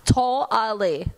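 TOTALLY slow, in separate syllables; copying pronunciation. Retold or imitated. Adult echoing a child's correction, syllable by syllable. Echoes child's sounded-out word.

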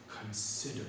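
"consider" set apart by a pause, low and soft; emphasis on CONSIDER. Shown as a demonstration. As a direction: weigh the word "consider" on its own. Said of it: "emphasizing that word".

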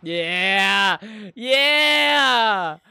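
loud cheering yeah. Real, not acted. Cheering at screen.

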